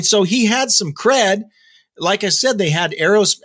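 usual speaking voice

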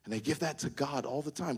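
ordinary talk, nothing marked

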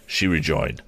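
plain reading voice

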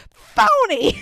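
high, loud yelled accusation; mock yell. Dorothy yells at the Wiz, a loud high mock yell. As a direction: throw the word out like Dorothy. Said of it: "she just yells".

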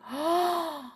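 big dramatic intake of breath; storyteller's scandalised gasp; shocked gasp. Acted. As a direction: gasp in horror. Narrator reacts to thrown pound.

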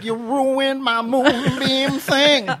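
high, slow, wounded whine; high slow [name] voice. Acted. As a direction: whine it like a hurt crooner. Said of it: "He's like".

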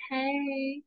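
high, gushing Southern greeting; playful Southern-accented hello. Pretend. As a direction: put on a sugary Southern accent. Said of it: "I'm going to adopt a Southern accent".

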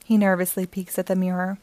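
usual speaking voice

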